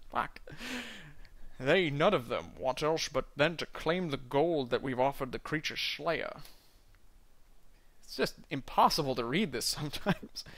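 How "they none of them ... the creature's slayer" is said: Scottish burr, rolled and heavy, dwarf in a tavern; a Scottish dwarf doing his best Connery; Sean Connery impression. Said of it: "do a Sean Connery impression".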